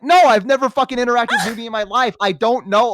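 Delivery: high, loud indignant protest; exasperated denial. Retold or imitated. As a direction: deny it emphatically, fed up. Acting out exasperated denial to roommate.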